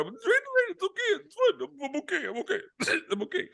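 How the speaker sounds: exaggerated choked-up squeak, insisting all is fine; mock emotional. Rider urging his friend to stay on, mock emotional squeak. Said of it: "I'm starting to get emotional".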